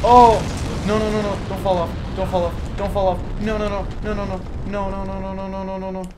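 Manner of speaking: loud high yelp into rapid pleading; high, urgent string of panicked repeats. Real, not acted. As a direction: cry out high as if about to fall. Nearly falling off in game.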